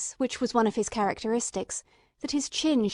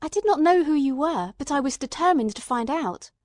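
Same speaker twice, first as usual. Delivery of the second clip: determined; 5 semitones higher, 5 dB louder than usual. Acted. Watson asserts his determination.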